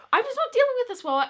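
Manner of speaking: high, fast, sobbing through the words; half-sobbing it out, voice up high; crying. Retold or imitated. Student at school panicking before a needle, crying. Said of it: "Like, I was crying".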